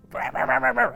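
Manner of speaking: mock dog bark, high and punchy; barking imitation. Narrator imitating the attack dog barking at people. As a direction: bark it out like an angry little dog. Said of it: "he becomes this attack dog".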